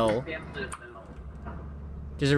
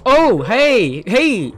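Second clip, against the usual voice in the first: startled call of hey; jolted, calling out; 6 semitones higher, 7 dB louder, much slower than usual. Real, not acted. Startled by a monster.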